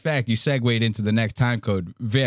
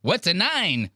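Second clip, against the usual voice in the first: 1 imitating Mark; 6 semitones higher than usual. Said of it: "You hear Mark?".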